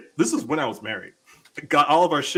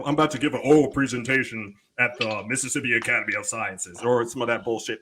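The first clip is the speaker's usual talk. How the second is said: usual speaking voice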